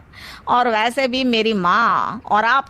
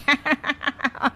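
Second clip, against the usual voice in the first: a soft, embarrassed chuckle trailing off; nervous laugh; 3 semitones higher, 4 dB quieter than usual. Acted. Jaggu caught out by the MP, nervous chuckle. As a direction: laugh to cover being caught.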